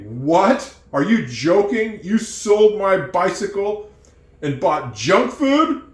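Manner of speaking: shocked and furious, stretching each word; outraged disbelief. Acted. Owner finds his bike was sold for junk food, outraged. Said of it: "And you get really mad".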